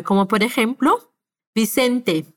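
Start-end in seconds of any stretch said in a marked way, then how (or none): 1.5-2.3 Vicente with a soft, gentle B at the start; Vicente, the B barely closed. Shown as a demonstration. Teacher demonstrating the soft B for learners, gentle. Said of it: "para una B más suave".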